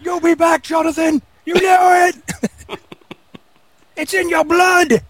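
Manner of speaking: high, shouted boss voice calling after him; boss yelling. Retold or imitated. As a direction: bellow it like a boss who knows he'll return. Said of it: "somebody playing his boss".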